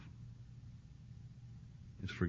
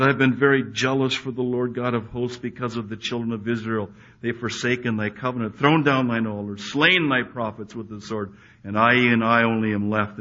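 loud, raised, aggrieved protest; Elijah's complaint; 3 semitones higher, 10 dB louder than usual. Retold or imitated. Elijah pouring out his grievance to God, loudly. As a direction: preach Elijah's lament at full volume.

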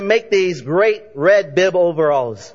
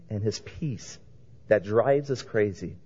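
soft; 10 semitones lower, 11 dB quieter than usual. Real, not acted. Preacher softens on pastor's peace.

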